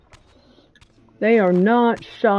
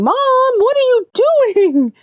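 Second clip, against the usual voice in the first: cat voice; 4 dB louder than usual. Retold or imitated. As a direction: voice the cat calling for mom. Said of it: "he kept going".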